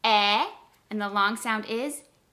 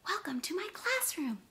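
high but much softer excited echo; echoed excitement; 9 semitones higher, 7 dB quieter than usual. Shown as a demonstration. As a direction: repeat it with eager excitement, softly. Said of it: "repeat the sentence just like I said it".

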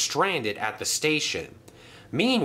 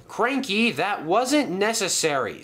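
high, indignant telling-off; reproving; 9 semitones higher than usual. A narrator's reading. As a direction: scold a careless workmate. Engine reproves the crane.

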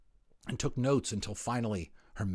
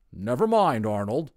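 bristling anger; 6 semitones higher, 6 dB louder than usual. Acted. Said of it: "Mister Otter bristled with anger".

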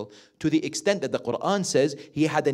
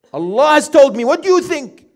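much higher and louder, pressing the question; a father raising his voice to ask his son's view; earnest asking; 10 semitones higher, 11 dB louder than usual. Pretend. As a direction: put the question firmly to your son. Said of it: "would you go and say to your son".

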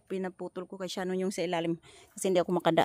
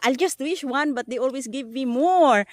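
higher, louder, thrilled chatter; excited; 8 semitones higher, 5 dB louder than usual. Real, not acted. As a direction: gush over the find. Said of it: "I'm just get excited".

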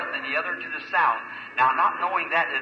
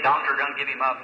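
usual speaking voice

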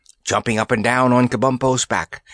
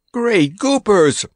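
put-on high, drawn-out boyish exclamation; astonished exclamation; 10 semitones higher, 4 dB louder, slower than usual. A narrator's reading. Randy can hardly believe his luck, astonished exclamation. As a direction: exclaim it high and slow in amazement. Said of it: "exclaimed Randy".

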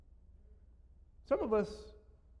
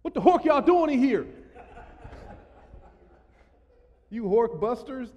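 higher and louder, laughing through the words; laughing mock taunt; 7 semitones higher, 8 dB louder than usual. Pastor joking with the crowd, laughing mock taunt. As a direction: taunt while cracking up.

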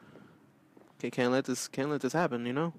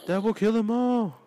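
high, rising hammed-up hero boast; mock heroic proclamation; Batman voice; 9 semitones higher than usual. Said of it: "I'm Batman".

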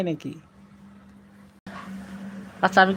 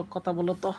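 ordinary talk, nothing marked

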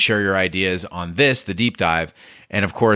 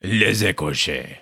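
slow, careful "Les écorchés"; French accent. Shown as a demonstration. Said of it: "Oh, it's perfect".

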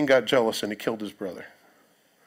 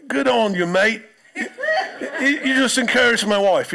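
mock Aussie cheer; 10 semitones higher, 4 dB louder than usual. Mocking the stranger who flirted.

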